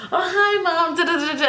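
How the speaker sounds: high sing-song fake greeting; fake cheery hi. Daughter faking a cheery hello to hide her nose piercing. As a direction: chirp it like nothing's wrong.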